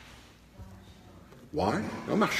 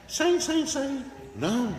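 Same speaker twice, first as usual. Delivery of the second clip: high demo; 8 semitones higher, 5 dB quieter, slower than usual. Shown as a demonstration. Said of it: "comment un ange crie saint?".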